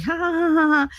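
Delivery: mocking laughter. Kids teasing, mocking laughter.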